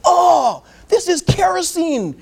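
high-pitched outraged yelling; hammed-up indignant outburst at being poisoned; mad, upset. Lawyer to clinic staff after tasting kerosene, angry upset yell. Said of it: "He's mad and he's, he's upset".

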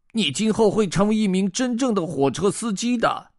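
high-pitched little fish voice; goldfish character. Acted. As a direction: speak up high as a talking goldfish. Narrator voicing the goldfish character.

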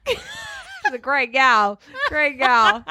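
lightly put-on Minnesota accent; slow, laughing Minnesota drawl. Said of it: "my Minnesota accent".

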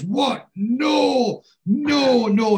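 high, loud anguished cries of no; breaking down. Retold or imitated. Oz learning his videos were cremated, high loud anguished cries. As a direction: wail it out as everything is lost. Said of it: "And Oz breaks down".

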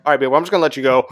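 hurried, very fast brush-off; rushed goodbye. Retold or imitated. Boyfriend hurrying to end a silent phone call, fast. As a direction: rattle the goodbye off quickly. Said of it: "I'm like".

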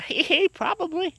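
high, giggly imitation of his mom; mock giggly mom. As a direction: tease it like a creepy cheerful mother. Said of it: "She said".

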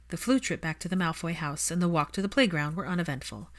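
ordinary delivery

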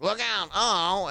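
high, slow cartoon warning; old video show voice. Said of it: "it was like".

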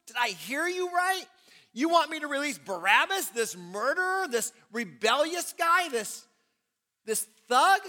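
loud, high disbelieving challenge; incredulous governor. Acted. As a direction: fire each question back at the mob in disbelief. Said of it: "The governor's like".

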